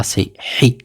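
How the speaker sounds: ordinary talk, nothing marked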